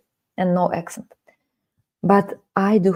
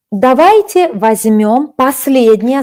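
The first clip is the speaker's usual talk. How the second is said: ordinary talk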